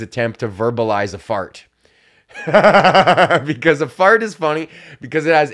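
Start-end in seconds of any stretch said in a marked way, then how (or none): 2.4-3.4 bursting laugh, louder. Real, not acted. Laughing at his fart philosophy. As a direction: crack up.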